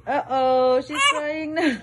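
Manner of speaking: half-laughing, half-worried. Real, not acted. Mother laughing as the baby starts crying, laughing uh-oh.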